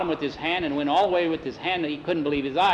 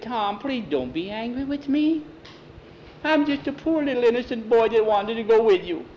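put-on high-pitched, wheedling child voice; pleading little boy; 8 semitones higher, 4 dB louder than usual. A narrator's reading. Skeeter begs Tom not to be angry, pleading boy voice. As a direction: beg like a poor innocent little boy.

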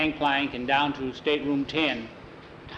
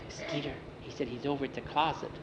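much softer, hurried hush; hushed warning; 11 dB quieter, faster than usual. Acted. Boys hiding, must stay quiet.

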